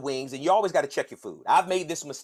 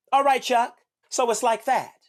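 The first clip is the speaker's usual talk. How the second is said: teasing call-out; 8 semitones higher, 4 dB louder than usual. Pretend. Calling out Chuck teasingly.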